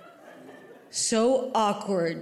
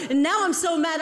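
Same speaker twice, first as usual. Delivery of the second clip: ordinary talk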